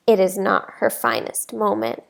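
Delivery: plain reading voice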